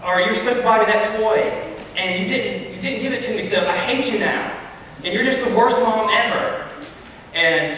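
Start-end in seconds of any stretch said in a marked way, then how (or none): 0.0-6.6 put-on high whining kid voice; whiny child. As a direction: nag mom like a bratty kid. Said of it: "they say".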